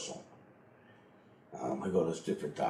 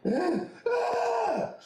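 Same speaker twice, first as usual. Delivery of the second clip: high piercing mock scream of waking up in a mess; a loud retold startled scream; 5 dB louder than usual. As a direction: shriek it like you just woke up covered in it. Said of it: "I'd scream".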